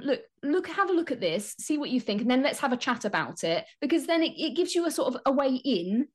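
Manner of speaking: ordinary delivery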